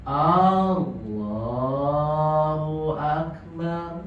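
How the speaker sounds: slow chanted "Allahu" with a long first A; old imam's drawn-out takbir; imitated elderly imam. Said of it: "kalau jadi imam orang-orang udah tua".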